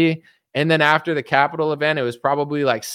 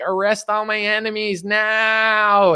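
higher, slow mocking voice of a Q believer; sneering caricature, pitched up; mocking whiny demand; 7 semitones higher, slower than usual. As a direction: drag it out like a spoiled tantrum. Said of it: "They're like".

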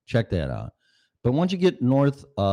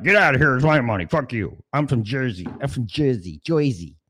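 a loud, higher-pitched angry rant; hammed-up loud Jersey retiree snarl; grumpy old rich guy; 5 semitones higher, 4 dB louder than usual. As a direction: bellow like a stingy old man defending his money.

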